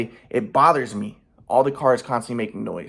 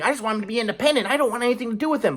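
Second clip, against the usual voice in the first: high, fast mocking mom voice; 8 semitones higher, faster than usual. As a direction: mimic mom's cold dismissal, high and quick. Mom voiced, mocking her view.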